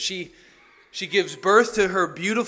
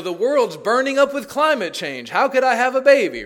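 exasperated complaint; 7 semitones higher, 9 dB louder than usual. Retold or imitated. Mocking voiced argument against children.

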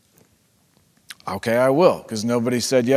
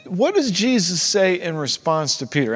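normal talking voice

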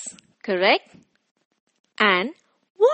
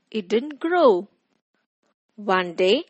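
ordinary delivery, nothing marked

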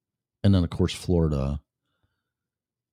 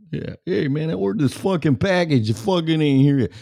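higher, hammed-up New York accent; mock New York accent, pushy and rude; 11 semitones higher than usual. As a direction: put on a thick New York accent for the angry caller. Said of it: "Did they talk like".

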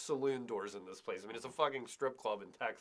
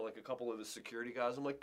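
normal talking voice

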